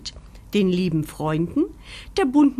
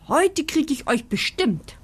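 freudig; 7 semitones higher than usual. A narrator's reading. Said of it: "freut sich Locke".

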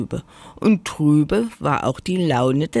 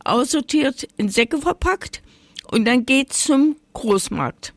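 high-pitched potato complaint; hammed-up high, woeful voice; plaintive lament; 8 semitones higher than usual. A narrator's reading. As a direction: list the woes in a high voice. Potato goes on lamenting its fate.

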